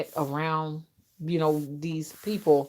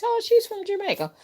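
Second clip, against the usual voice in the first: put-on little kids; 14 semitones higher than usual. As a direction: chirp it like a small kid pointing her out. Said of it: "I hear little kids".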